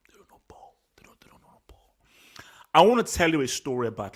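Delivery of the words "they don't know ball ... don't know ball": dropping into a bare whisper; hushed disbelief. Real, not acted. Analyst on people praising Atletico, whispered dismissively. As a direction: mutter it low, trailing into a whisper. Dismissive aside, repeated under breath.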